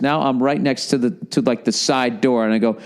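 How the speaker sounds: ordinary talk, nothing marked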